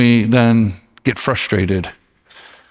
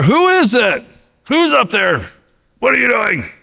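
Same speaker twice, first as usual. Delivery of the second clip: high, raised shout out the window; king yelling; 10 semitones higher than usual. Acted. King yells up at the noise on his roof, high shout. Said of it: "he yelled out there".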